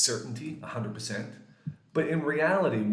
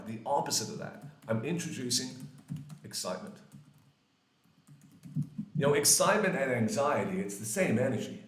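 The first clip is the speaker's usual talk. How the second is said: ordinary talk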